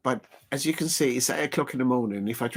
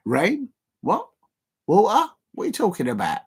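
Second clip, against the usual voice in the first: mock bafflement; 7 semitones higher than usual. Mocking drivers confused by rain.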